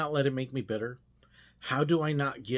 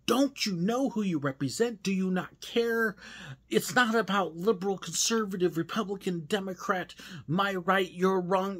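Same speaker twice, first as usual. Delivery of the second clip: exasperated scolding; 7 semitones higher than usual. Retold or imitated. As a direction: scold them in frustration, voice up high. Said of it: "I want to reach out and go".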